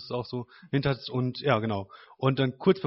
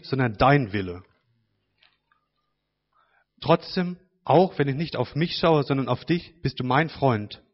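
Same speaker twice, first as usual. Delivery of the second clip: louder, slow, heartfelt prayer to Father; reading a prayer aloud; 2 semitones higher, 4 dB louder than usual. Acted. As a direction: speak the written prayer straight to God. Reads own prayer to God. (laut und innig vorgelesenes Gebet)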